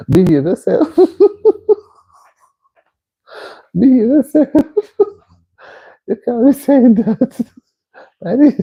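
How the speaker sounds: high-pitched, breaking up with real laughter; laughing scold. Real, not acted. Host telling a cheeky viewer off, laughing. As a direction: scold through helpless giggles.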